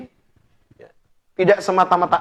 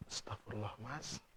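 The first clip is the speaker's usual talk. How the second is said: hushed shocked gasp; 8 semitones lower, 29 dB quieter than usual. Retold or imitated. Wife shocked by the answer.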